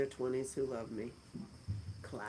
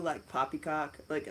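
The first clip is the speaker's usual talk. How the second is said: normal talking voice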